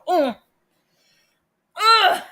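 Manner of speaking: a squeaky, loud, startled uh; dumbfounded stammer. Pretend. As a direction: play a stunned, stuck panelist. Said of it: "we're just gonna go".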